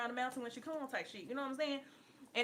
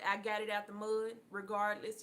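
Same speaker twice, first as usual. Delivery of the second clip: ordinary talk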